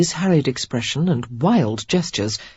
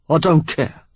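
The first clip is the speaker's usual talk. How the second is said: interrupting. A narrator's reading. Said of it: "Derek interrupted".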